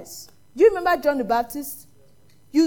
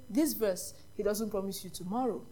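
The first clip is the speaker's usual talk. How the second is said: ordinary delivery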